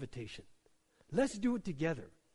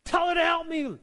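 higher and louder, a sharp demand; demanding exclamation; 10 semitones higher, 7 dB louder than usual. Retold or imitated. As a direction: bark it out like an order. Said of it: "there's an exclamation mark on it".

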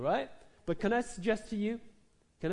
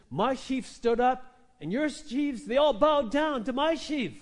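louder, higher, slow proud boast; young Joseph bragging to his brothers; boastful dream telling; 5 semitones higher, 5 dB louder than usual. Acted. Joseph bragging to his brothers about his dream, excited and proud.

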